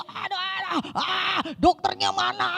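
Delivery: high, pained moaning, slowed down; groaning patient. Retold or imitated. Patient in the hospital bed groaning for the doctor, pained moan.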